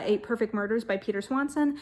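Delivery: ordinary delivery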